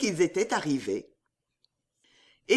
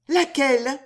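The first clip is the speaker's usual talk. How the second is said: high, louder, curious; eager question; 6 semitones higher, 5 dB louder than usual. Acted. Riquette asking Riquet for his idea, high and eager. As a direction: pounce on the news.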